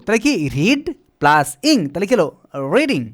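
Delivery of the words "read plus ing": slow dictation. Shown as a demonstration. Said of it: "teacher spelling out form".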